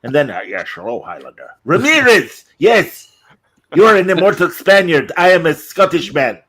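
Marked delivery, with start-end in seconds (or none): 0.5-1.5 low, soft put-on character voice; mock film mentor. Older immortal greeting the Highlander, low mock character voice. 1.6-6.4 high, loud mock French-accented hero; Christopher Lambert. Highlander addressing Ramirez, excited Lambert impression. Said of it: "Yes, Christopher Lambert".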